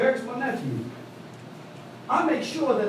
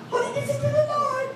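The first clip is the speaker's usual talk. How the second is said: imitating mother-in-law.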